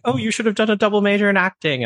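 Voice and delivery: mock father's teasing, high and fast; dad's remark. Father teasing his son after the show, high and fast. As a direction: tease the son off the stage like his dad. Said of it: "him going".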